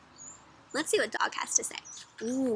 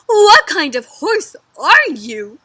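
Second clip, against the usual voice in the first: loud, slow, swooping disbelief; shocked; 4 semitones higher, 14 dB louder, much slower than usual. A narrator's reading. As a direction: stretch it out, appalled. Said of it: "They're so shocked, right?".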